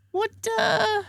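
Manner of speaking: high, slow puzzled voice as the Wi-Fi guy; quoting Scottish guy. Retold or imitated. Retelling the Wi-Fi guy's puzzled question, high and slow. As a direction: pitch up and draw it out, baffled.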